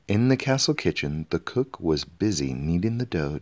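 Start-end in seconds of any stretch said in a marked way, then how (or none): none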